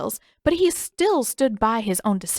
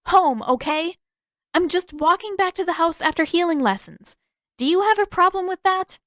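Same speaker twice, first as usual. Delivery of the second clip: put-on much higher, louder, defiant girl voice; 10 semitones higher, 3 dB louder than usual. A narrator's reading. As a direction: push back at a nosy brother. Sister defends herself angrily.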